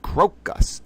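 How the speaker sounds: Crocus said as krow-kus, first syllable rhyming with grow, in a near whisper; correct pronunciation. Shown as a demonstration. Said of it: "crocus is how it's said".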